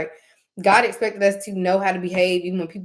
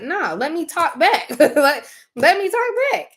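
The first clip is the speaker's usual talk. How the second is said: defiant; 9 semitones higher, 4 dB louder than usual. Real, not acted. Mocking bullies who won't talk.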